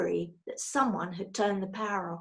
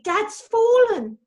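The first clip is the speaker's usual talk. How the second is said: much higher, louder, slow horrified shriek; 12 semitones higher, 9 dB louder, slower than usual. A narrator's reading. Daughter seeing dad fall, horrified shriek. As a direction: scream it in terror. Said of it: "shrieked Judy".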